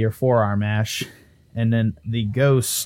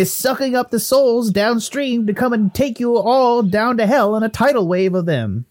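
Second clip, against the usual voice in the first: higher Irish-Canadian ghost voice; urgent ghost Willie; 11 semitones higher, 4 dB louder than usual. Acted. Ghost warning of a tidal wave of souls, urgent. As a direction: warn them emphatically in a lilting mixed accent.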